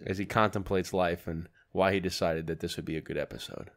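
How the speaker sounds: mock commentary. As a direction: narrate it like a nature documentary. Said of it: "Now, commentary".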